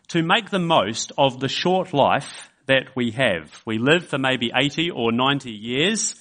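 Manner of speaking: normal talking voice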